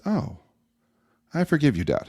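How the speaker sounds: a hint of a softened young daughter. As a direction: gently voice a ten-year-old forgiving her dad. Daughter forgives father.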